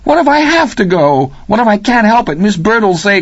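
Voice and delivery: put-on frightened kid's rising questions; bewildered, frightened. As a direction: voice climbing with worry. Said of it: "bewildered and a little frightened".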